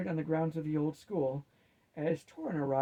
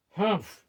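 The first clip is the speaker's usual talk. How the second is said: humph; 5 semitones higher, 8 dB louder than usual. Acted. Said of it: "said Morketh".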